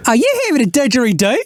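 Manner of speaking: very high, fast camp Australian shriek; a flamboyant Aussie scandalised at no Fosters; outraged camp Australian. Acted.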